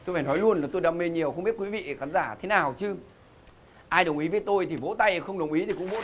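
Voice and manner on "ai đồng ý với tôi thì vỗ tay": ordinary delivery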